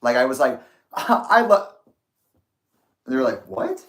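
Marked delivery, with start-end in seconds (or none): 0.9-1.7 nervous slip, high. Retold or imitated. Almost saying I love you. 3.5-3.8 surprised what. Retold or imitated. Partner's surprised reaction retold.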